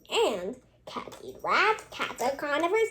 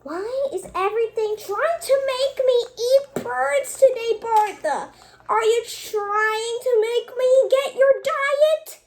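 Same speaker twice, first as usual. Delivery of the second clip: high, loud exasperated protest; high loud fed-up questioning; exasperated complaint; 7 semitones higher, 5 dB louder than usual. Acted. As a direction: demand to know why, fed up. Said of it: "started saying".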